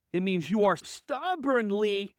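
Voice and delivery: ordinary talk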